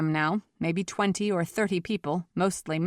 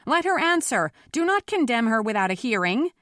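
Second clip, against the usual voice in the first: high, forceful appeal to a crowd; shouted plea; 6 semitones higher, 4 dB louder than usual. A narrator's reading. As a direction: demand a fair hearing from a mob. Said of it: "he shouted to the crowd".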